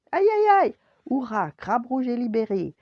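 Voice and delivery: high, loud ouch, then a jubilant shout; high-pitched cry easing into hurrah; yelp turning to cheer. A narrator's reading. Boy yelps in pain, then cheer.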